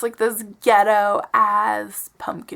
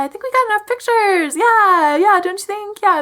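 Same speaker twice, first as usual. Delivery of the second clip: high, over-bright mock politeness; awkward polite refusal; 8 semitones higher, 6 dB louder than usual. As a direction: deflect with forced high-pitched agreeableness. Retelling her awkward polite refusal.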